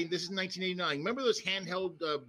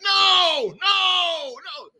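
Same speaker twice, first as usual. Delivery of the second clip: loud high shouted no; mock crowd roar; crowd shouting no; 12 semitones higher, 11 dB louder, much slower than usual. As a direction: yell it like a booing crowd. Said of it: "And they're like".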